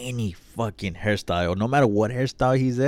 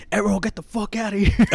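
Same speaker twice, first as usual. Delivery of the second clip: cussing everyone out; 11 semitones higher than usual. Retold or imitated. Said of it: "So I started cussing out everyone".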